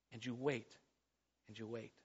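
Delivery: very soft, low, fading away; weary waiting. Acted. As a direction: let each repetition sink nearer to silence. Preacher acts out endless waiting.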